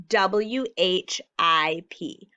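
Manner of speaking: slow letter by letter W H I P; slow spelling. Shown as a demonstration. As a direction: spell W H I P out slowly. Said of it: "spelling slowly".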